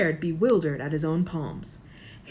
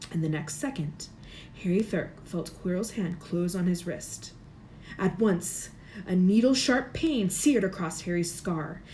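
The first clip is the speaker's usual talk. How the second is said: ordinary delivery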